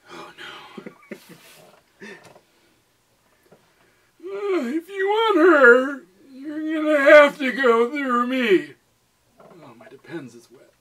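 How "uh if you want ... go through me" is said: very high, loud slow wail; mock sobbing. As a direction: overdo a melodramatic cry. Dramatic reading of line.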